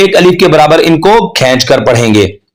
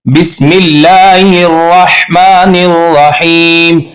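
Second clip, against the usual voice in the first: slow chanted but with wrong raa; wrong recitation; slower than usual. Shown as a demonstration. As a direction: recite the mistaken way. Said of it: "अब हम इसको ऐसे नहीं पढ़ेंगे". (गलत तिलावत)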